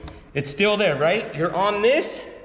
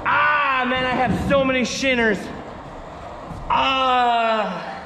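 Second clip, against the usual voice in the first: pained groaning; 4 semitones higher, slower than usual. Real, not acted. Still in pain after crash.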